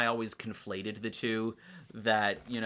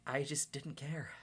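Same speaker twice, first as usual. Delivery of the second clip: whispered; 4 semitones higher, 7 dB quieter than usual. Real, not acted. Dismissive aside about [name].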